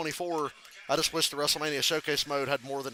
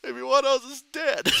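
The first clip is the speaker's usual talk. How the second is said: laughing; 8 semitones higher than usual. Real, not acted.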